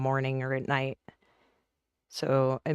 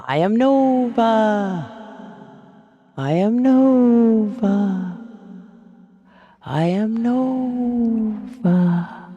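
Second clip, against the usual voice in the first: slow, loud, higher grand proclamation; grandly granting a wish; 6 semitones higher, 6 dB louder, much slower than usual. Pretend. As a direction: announce it like a mighty wizard.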